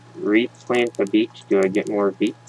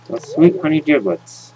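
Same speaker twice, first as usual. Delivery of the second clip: excited; 8 semitones higher, 3 dB louder than usual. Real, not acted. Mock exclamation.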